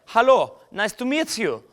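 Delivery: high-pitched mock German accent. Shown as a demonstration. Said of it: "Doing, like, a German accent".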